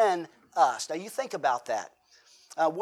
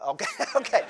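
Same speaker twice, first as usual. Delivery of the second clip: laughing concession; 3 semitones higher, faster than usual. Retold or imitated. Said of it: "sons conceding".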